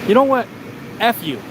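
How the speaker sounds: mock telling-off with a punched opening; retold angry retort. Retold angry retort before hanging up.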